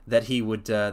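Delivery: plain reading voice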